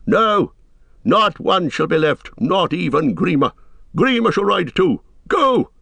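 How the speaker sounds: high, emphatic royal order; king overruling his counselor; commanding. A narrator's reading. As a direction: build to a high, sharp dismissal.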